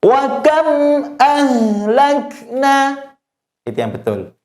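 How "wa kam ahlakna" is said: high, slow recitation with KAM's M sounded clearly, unheld; clear mim. Shown as a demonstration. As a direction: chant WA KAM, close the M cleanly and go on. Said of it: "sekarang mimnya dibaca jelas".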